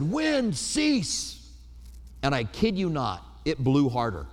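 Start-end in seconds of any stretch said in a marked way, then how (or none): 0.0-1.5 high, sharp command to the wind; angry command. Retold or imitated. Pastor retelling ordering the wind as a teen, angry command. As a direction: order the wind to stop, much higher. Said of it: "got kind of a little bit upset, angry, and I said".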